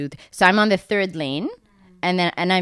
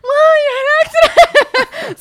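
hammed-up high loud shriek through laughter; mock panic; 10 dB louder than usual. Sister hears about the accident, hammed-up panicked shriek. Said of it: "So she starts panicking".

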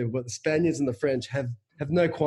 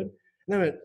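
ordinary talk, nothing marked

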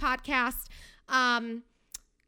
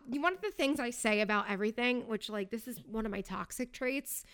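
ordinary talk, nothing marked

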